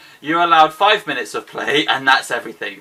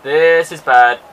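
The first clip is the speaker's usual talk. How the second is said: loud, drawn-out dismay; dismayed cry; 2 semitones higher, 8 dB louder, much slower than usual. Real, not acted. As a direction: groan it out loudly as disaster hits. Disaster during the match.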